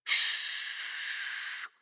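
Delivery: a breathy hushing shh. Real, not acted. Hushing the congregation mid-story. (轻声嘘)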